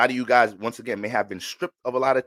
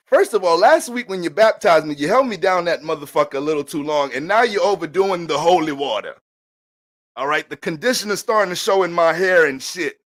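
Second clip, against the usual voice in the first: indignant complaint; 4 semitones higher, 6 dB louder than usual. Acted. Sister complaining to the pastor.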